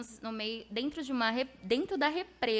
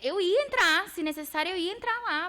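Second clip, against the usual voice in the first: determined declaration; 7 semitones higher, 5 dB louder than usual. Real, not acted. Insisting he would go in.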